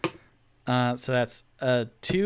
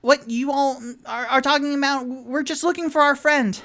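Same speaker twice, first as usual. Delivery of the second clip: high, loud, frantic rambling voice; flustered and squeaky, stammering; Charlie Day impression; 13 semitones higher, 6 dB louder than usual. Tipsy protesting to the halfling woman, high and frantic. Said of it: "his best Charlie Day impression".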